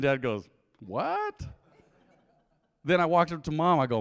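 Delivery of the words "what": high, rising mock-shocked question; shocked dad. Dad sees his son bald, comic shock. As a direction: squeak it upward in comic disbelief.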